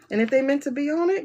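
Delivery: ordinary delivery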